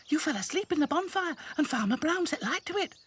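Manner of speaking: high little doll voice. Acted. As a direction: chirp it like a small doll. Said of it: "said Lucy".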